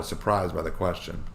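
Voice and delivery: usual speaking voice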